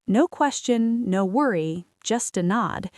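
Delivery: ordinary delivery, nothing marked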